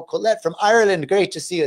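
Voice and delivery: playful, badly faked Irish accent; jokey attempt at an Irish lilt. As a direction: greet her in a poor mock Irish accent. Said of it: "That's my worst Irish accent".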